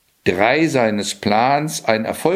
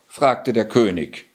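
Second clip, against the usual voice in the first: ordinary delivery, nothing marked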